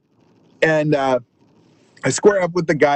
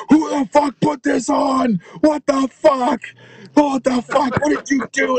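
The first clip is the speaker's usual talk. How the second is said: furious shout; 9 semitones higher than usual. Retold or imitated. Club owner storming through the club, yelling angrily. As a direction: storm in bellowing.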